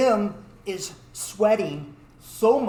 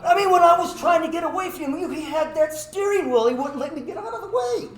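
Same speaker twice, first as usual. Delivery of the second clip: high, loud gush of blame; pitched-up mock indignation; frantic excuse; 10 semitones higher, 5 dB louder than usual. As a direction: pile excuses onto a friend. Frantically blaming friend to cops.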